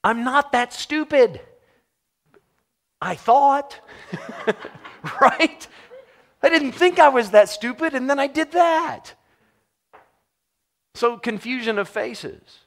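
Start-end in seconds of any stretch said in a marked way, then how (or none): 0.0-9.1 high, loud disbelief at his own foolishness; incredulous at himself. Retold or imitated. Preacher mocking his own foolishness, high and loud. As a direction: squeal it like you can't believe you did that.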